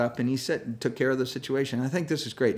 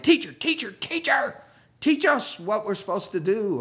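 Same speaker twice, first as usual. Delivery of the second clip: high, frantic calls of teacher; panicked whine; 9 semitones higher than usual. Retold or imitated. Panicked disciples waking Jesus, high pleading whine. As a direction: wake him with high pleading cries. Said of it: "they're like".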